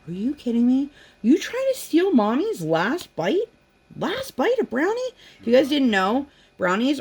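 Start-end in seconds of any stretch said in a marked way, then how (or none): 0.0-5.0 mock outrage. Mom confronting whoever tried stealing her last brownie bite, mock outrage. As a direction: act scandalized, voice climbing much higher.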